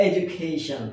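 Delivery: "এডুকেশন" said as "ej-u-KAY-shn"; proper pronunciation. Shown as a demonstration. Teacher giving learners the proper form, "এডুকেশন" as ej-u-KAY-shn. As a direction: say "এডুকেশন" with a j sound for the d. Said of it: "কি এটা?".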